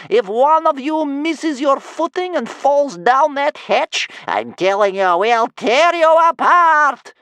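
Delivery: put-on very high, loud, slow old-woman scream; furious granny shrieking like a wolf; howling threat. A narrator's reading. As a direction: howl the threat, building to the last words. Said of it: "howling up at the ceiling like a wolf".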